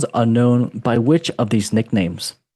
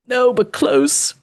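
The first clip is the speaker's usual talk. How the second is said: squeaky teasing no; 13 semitones higher, 3 dB louder, much slower than usual. Pretend. Teasing a wrong quiz guess.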